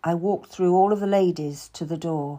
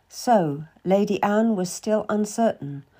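ordinary delivery, nothing marked

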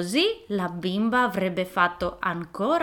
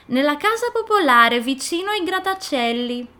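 put-on little girl voice; 7 semitones higher, 6 dB louder than usual. Girl answering the wolf.

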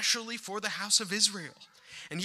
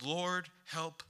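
quiet plea; 2 semitones lower, 8 dB quieter, slower than usual. Acted. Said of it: "All she said was".

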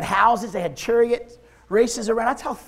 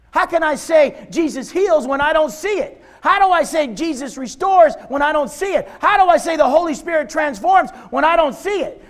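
loud, higher-pitched protest to God; retold anger at God; 7 semitones higher, 6 dB louder than usual. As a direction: cry out questions in mock-angry frustration. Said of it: "Because flesh was mad".

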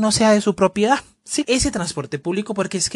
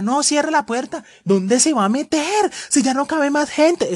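annoyed passenger; 9 semitones higher, slower than usual. Retold or imitated. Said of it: "Y por acá".

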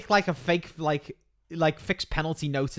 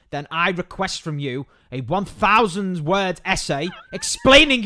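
slow, rising, louder royal decree; haughty decree; 6 dB louder than usual. Pretend. Friend dictates a pompous reply to the banned member. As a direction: pronounce judgment like a magistrate. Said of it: "you write back to him and you say".

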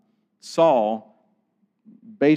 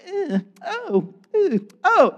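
high mock grunts of exertion; mimed effort grunts; slower than usual. Stone thrower hurling stones at Stephen, mimed effort grunts. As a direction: imitate heaving stones with pitched-up grunts.

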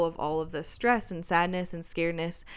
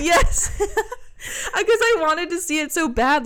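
real laughter, higher and louder; laughing; 11 semitones higher, 6 dB louder, slower than usual. Real, not acted. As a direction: laugh through the words, slowly. Laughing about asking for placenta.

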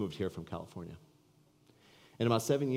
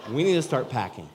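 excited urging; 4 semitones higher, 9 dB louder than usual. Retold or imitated. Repeated line, louder.

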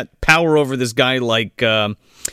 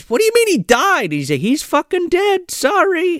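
mock exchange.

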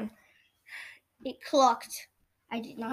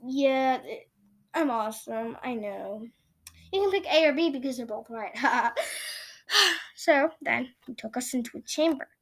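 usual speaking voice

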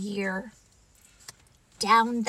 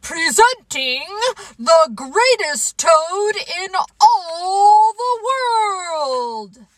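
grand, drawn-out, loud raised voice; strange faraway announcer; 8 semitones higher, 8 dB louder, slower than usual. Acted. Strange voice presenting Toad to the theater, grand announcing. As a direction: announce grandly, stretching the words out. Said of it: "A strange voice from far away said".

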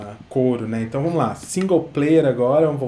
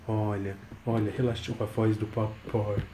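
softly hushed; 3 semitones lower, 7 dB quieter than usual. Real, not acted. Dad notes baby calmed by voice.

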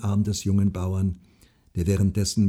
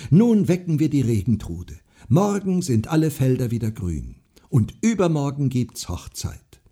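excited; 5 semitones higher, 5 dB louder than usual. A narrator's reading. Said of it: "rief Marin".

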